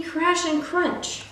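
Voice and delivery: ordinary delivery, nothing marked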